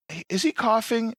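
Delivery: high, slow worried voice; a hint of a fussing wife; mock wife. Storyteller voicing [name]'s wife high and slow. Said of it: "his wife is like".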